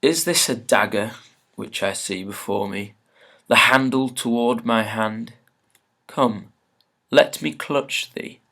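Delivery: slow, measured Shakespeare recital; dramatic verse reading. Acted. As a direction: declaim the soliloquy with weighted key words. Reads Macbeth's speech aloud.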